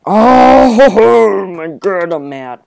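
loud high cry breaking into a laugh; shouted oh laughing my god; frustrated shout. Real, not acted. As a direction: yell in outraged disbelief. Gets killed, laughs, says I'm mad.

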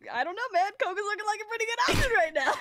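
high, loud gushing through laughter; giggly fanboy. Retold or imitated. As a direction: swoon over him while laughing. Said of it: "and I'm like".

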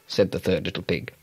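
plain reading voice

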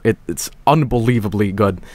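normal talking voice

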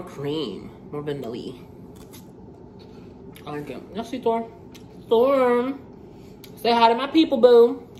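talking while chewing; snacking between words; mouth partly full. Real, not acted. Seen 1: Bites roll at -1, lips pursed chewing, bites again near 3.3.

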